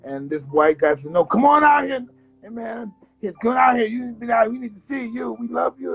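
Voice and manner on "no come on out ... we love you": high calling voice of an inmate. Retold or imitated. Inmate calling him out of the cell, high eager call.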